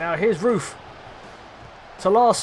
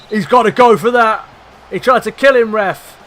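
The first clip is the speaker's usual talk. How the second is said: much louder, higher protest to the ref; loud outraged shout; 4 semitones higher, 9 dB louder than usual. Real, not acted. Fan yelling at ref over a foul, loud outrage. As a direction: yell at the referee, then jeer the sent-off player. Red card foul reaction.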